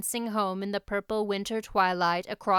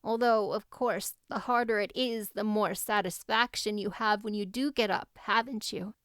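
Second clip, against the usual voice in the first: ordinary delivery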